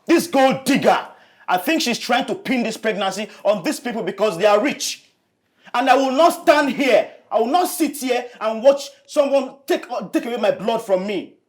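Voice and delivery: angry outburst. Acted. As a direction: rail at them, voice climbing. Angry rant at the family.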